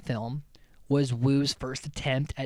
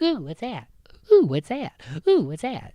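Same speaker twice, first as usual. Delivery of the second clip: high, loud cartoon squeal of curiosity; Gir; 7 semitones higher, 8 dB louder than usual. Podcaster likening the scene to a cartoon, high silly voice. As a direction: repeat it in a silly, excitable little voice. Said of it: "it's like Gurr in Invader Zim".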